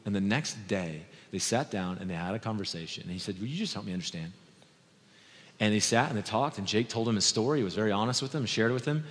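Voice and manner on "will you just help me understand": soft, low-key asking; gentle request. Retold or imitated. Chet asking Jake over lunch, gently and softly. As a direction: ask it quietly, without accusation.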